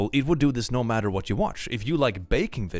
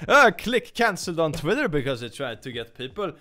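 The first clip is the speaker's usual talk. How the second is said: mock news headline; 5 semitones higher than usual. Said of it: "I wake up to".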